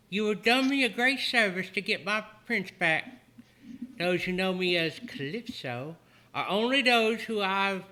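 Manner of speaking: put-on sweet high older woman; Calypso voice. GM voicing Calypso.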